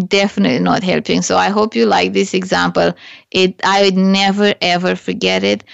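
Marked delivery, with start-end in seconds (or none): 0.1-1.2 fast, tossed-off repeat of "Definitely not helping."; fast emphatic echo of "Definitely not helping."; quick repeat. Shown as a demonstration. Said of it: "you're definitely not helping".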